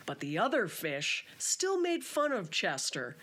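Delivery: storybook reading voice; gentle children's book narrator; story narration. Acted. Reads children's book aloud.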